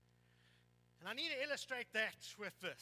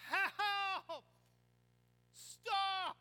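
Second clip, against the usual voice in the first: high, loud cry for help; shouted plea; 10 semitones higher, 11 dB louder than usual. Retold or imitated. Preacher voicing people in life's struggles, shouting for help. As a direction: call out as if drowning in trouble.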